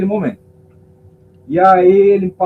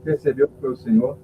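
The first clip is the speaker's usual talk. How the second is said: ordinary delivery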